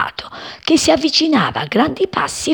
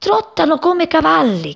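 high, urgent calling out; little bird crying out in alarm; excited cry; 7 semitones higher, slower than usual. A narrator's reading. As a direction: call a friend to look, pitch climbing. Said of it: "gridò Cipì".

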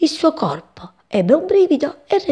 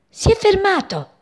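much higher, light sparrow voice; little hen sparrow; 11 semitones higher than usual. A narrator's reading. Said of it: "osservò la passeretta". (voce acuta di passeretta)

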